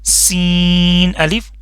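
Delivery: سین said with a sharp whistling s, drawn out slowly; whistling sin. Shown as a demonstration. Teacher showing students the whistling سین, slow. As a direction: let the s hiss like a whistle in سین. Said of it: "سو نہیں پڑھنا".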